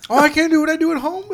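high, quick, through laughter; joke-protest while cracking up; laughing mock complaint.

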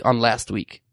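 ordinary talk

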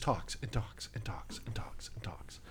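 hushed, slow whisper. Real, not acted. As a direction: breathe it out under the breath. Complaining about a talkative character.

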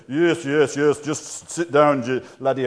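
lightly attempted Scottish accent; badly faked stern Scottish consultant; terrible Scottish accent. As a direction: put on a poor Scottish accent. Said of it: "terrible Scottish accent".

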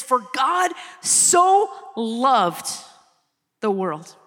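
drawn out slowly, each word weighed; slow reverent quote. Acted. Preacher to congregation, reading the verse slowly. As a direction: savor every word of the verse.

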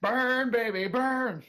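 high, loud gleeful cry; gleeful mock killer. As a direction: crow it like a laughing maniac. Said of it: "screaming in laughter as you kill someone".

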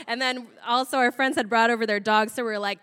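normal talking voice

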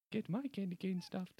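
high, suggestive voice; saucy. Acted. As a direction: flirt it, high and cheeky. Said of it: "He's saucy".

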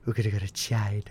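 a weak attempt at Trump's American accent; failed Trump impression, barely American. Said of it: "I can't do his accent".

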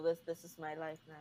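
low soft mock calm; quiet. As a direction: murmur it like a joke.

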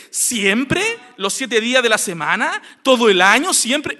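loud, rising challenge on "siempre"; incredulous questioning. Shown as a demonstration. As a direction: question "siempre" as if cross-examining. Challenges the exaggerated word always.

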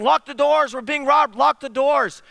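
imitated screaming. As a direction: imitate her urgent high-pitched alarm. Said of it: "I hear this screaming".

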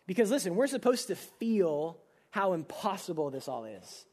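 normal talking voice

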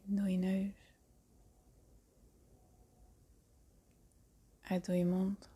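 hushed and calm, breathy at times; soft spa whisper. Acted. Leads a guided meditation.

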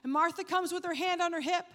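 loud, high, driving sermon voice; full-force preaching. Acted. Preacher driving home the story of Martha to the congregation, loud and fervent. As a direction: preach it hard, building the story.

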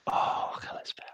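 whispering Kalispera softly; whispered. Real, not acted. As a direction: breathe the greeting. Whispered echo of Greek greeting.

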